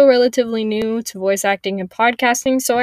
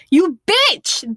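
sudden loud high yell; 10 semitones higher, 7 dB louder than usual. Acted. A monster yelling at Lacey as she bites, loud yell. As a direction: hurl the insult at her. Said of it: "they yell".

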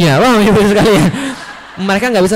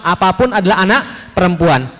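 ordinary delivery

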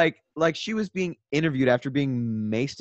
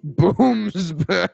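laughing echo; 5 semitones higher, 6 dB louder than usual. Acted. Echoing the silly name laughing.

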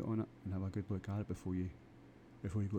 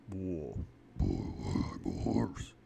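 much lower, louder, booming horse; big deep horse voice; 3 semitones lower, 4 dB louder, much slower than usual. Retold or imitated. Imagining how a real horse would talk, big and booming. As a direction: boom it out like a huge intimidating horse. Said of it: "A big, a big deep voice".

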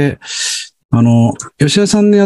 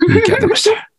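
キャー said much higher, thrilled; excited; faster than usual. Real, not acted. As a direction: lift 出ました up in a delighted squeal.

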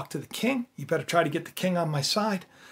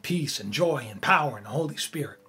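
lifted, louder preacher's build; fervent preaching; 3 semitones higher, 4 dB louder than usual. Acted. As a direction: preach it with rising conviction. Preaches God's will.

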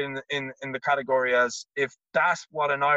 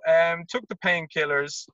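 ordinary talk, nothing marked

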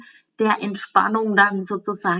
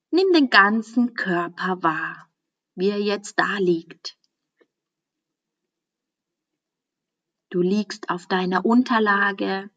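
guided meditation. Acted. Teacher guiding a listener into Yoga Nidra, calm meditation voice. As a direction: ease the listener into stillness, unhurried.

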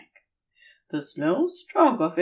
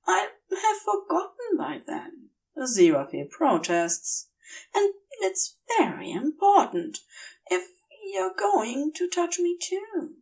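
dazed Aziraphale; 9 semitones higher than usual. Acted. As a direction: let him sound woozy and earnest. Aziraphale's voice, higher.

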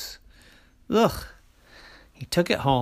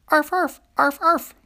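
high little arfs, louder; puppy barking; 14 semitones higher, 5 dB louder than usual. Acted. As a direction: yip like a small dog. Walk the dog trick again.

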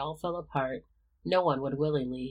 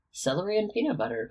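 raised eyebrow; 7 semitones higher, faster than usual. A narrator's reading. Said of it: "Remus said with a raised eyebrow".

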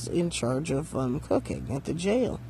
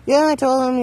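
loud, high, dismissive brush-off; her attitude; 8 semitones higher, 9 dB louder than usual. Retold or imitated. Roommate back from the driveway, reporting to the speaker with attitude. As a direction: toss it off with a louder, higher attitude. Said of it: "in her attitude".

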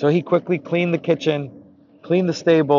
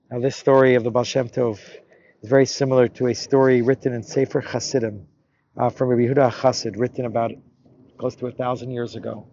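usual speaking voice

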